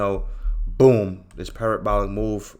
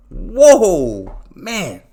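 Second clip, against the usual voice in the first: shocked whoa; 6 semitones higher, 10 dB louder than usual. Real, not acted. Reacting to viewer's silly logic.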